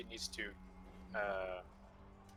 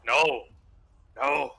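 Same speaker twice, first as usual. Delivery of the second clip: loud urgent no; urgent shout; 10 dB louder than usual. Acted. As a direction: stop him with a sharp shout. Stops ally from charging in.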